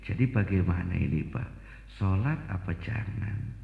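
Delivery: put-on a nervous subordinate bowing before the old cleric; timid report to elder. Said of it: "Nganu, Mbah". (melapor pelan dan rendah dengan takut-takut)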